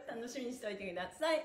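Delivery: usual speaking voice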